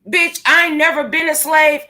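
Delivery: ordinary talk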